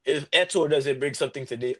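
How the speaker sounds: ordinary delivery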